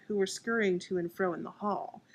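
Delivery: usual speaking voice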